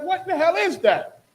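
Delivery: high, loud startled what-the-hell; startled shriek. Retold or imitated. Whoopi's character startled by a ghost's voice, high shocked cry. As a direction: jump at a voice out of nowhere. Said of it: "and she like".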